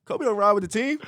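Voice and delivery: high, loud retold surprise; mock disbelief. Player setting waiting fans straight, loud mock disbelief. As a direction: exclaim it high, as if they should know.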